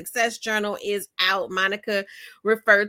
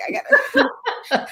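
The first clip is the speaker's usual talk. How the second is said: high-pitched words dissolving into laughter; laughing urgency; 13 semitones higher, much slower than usual. Retold or imitated. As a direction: squeeze it out slowly through laughs. Laughing at own urgent resolve.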